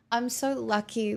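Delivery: ordinary delivery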